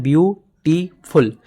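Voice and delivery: Beautiful said slowly as view, टी, फुल; teacher sounding out beautiful in three parts; broken into syllables. Shown as a demonstration. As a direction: split beautiful with two short stops. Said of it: "बीच में जो दो stop आया ना".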